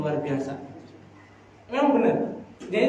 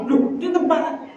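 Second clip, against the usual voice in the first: higher-pitched mock indignation; retold angry protest; 6 semitones higher than usual. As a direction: imitate someone mildly cross, voice pitched up. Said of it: "Dia marah-marah seperti itu ya".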